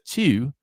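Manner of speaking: TWO fronted to "tyew"; Baltimore pronunciation. Retold or imitated. Host imitating a slangy Baltimore dude, Baltimore pronunciation. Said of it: "but then he says two".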